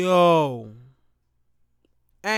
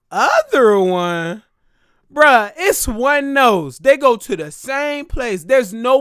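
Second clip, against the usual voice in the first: louder, slow, outraged: other one?!; indignant outburst; 2 semitones higher, 8 dB louder than usual. Retold or imitated. To the doctor about the second nostril, slow loud outrage. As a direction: protest loudly, dragging the words out in disbelief.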